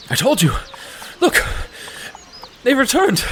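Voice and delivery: high, excited exclamations; excited guard. Acted. Guard to mayor about returned prisoners, excited cry.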